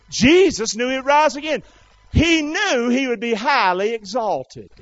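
high, loud, shouted sermon; full-force preaching. Real, not acted. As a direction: cry it out to the whole congregation.

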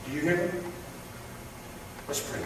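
usual speaking voice

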